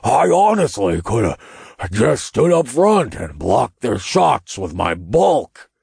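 bulky sore loser voice; grumbling. A narrator's reading. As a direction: grumble with heavy emphasis. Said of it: "I heard Mammon grumble".

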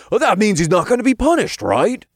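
fast, high, loud question; eager imp. A narrator's reading. Imp checks friend won't be punished, eager. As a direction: rush to the hopeful conclusion.

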